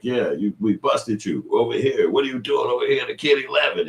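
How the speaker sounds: high, loud accusing voice; mock TV host. A show host busting a predator, high and loud. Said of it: "they'll be like".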